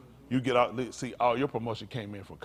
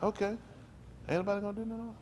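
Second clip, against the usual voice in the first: high, softer quoted question; looking around, questioning; 10 semitones higher, 5 dB quieter, faster than usual. Acted. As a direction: act out someone checking if anyone will step in. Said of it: "he looked around like".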